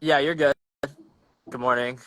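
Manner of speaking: ordinary talk, nothing marked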